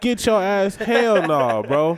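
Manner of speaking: loud, high, half-laughing; alarmed outburst. Real, not acted. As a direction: react like you just saw a tiger. Shocked at tigers in jungle.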